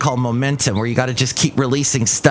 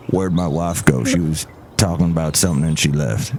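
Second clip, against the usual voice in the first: deep, low-pitched husband character; visiting comic character; 9 semitones lower than usual. Acted. Visitor looking for his wife, very low character voice. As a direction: sink the voice for the puzzled visitor. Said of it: "Look who else is here".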